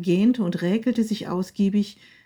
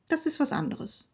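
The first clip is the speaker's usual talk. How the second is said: high, softer dismissal; curt reply; 6 semitones higher, 7 dB quieter than usual. A narrator's reading. Wife dismisses husband, softer. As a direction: brush him off.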